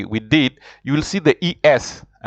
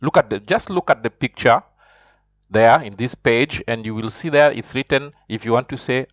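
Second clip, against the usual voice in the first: ordinary talk, nothing marked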